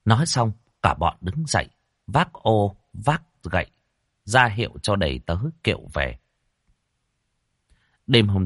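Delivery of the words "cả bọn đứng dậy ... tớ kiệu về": ordinary delivery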